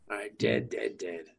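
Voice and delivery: deep, low and quiet growl; Batman voice. Retold or imitated. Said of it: "So much Batman voice".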